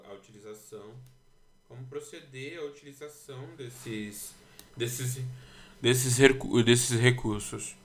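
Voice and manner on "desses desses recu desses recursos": far from mic. Real, not acted. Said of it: "tá meio estourado agora, porque eu tô longe do microfone".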